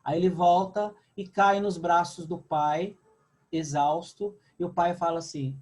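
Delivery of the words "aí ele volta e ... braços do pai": usual speaking voice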